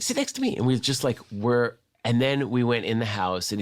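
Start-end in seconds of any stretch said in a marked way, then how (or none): 0.0-0.6 very high imitated director; Quentin Tarantino. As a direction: mimic a director's invitation in a high voice. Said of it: "and he's like".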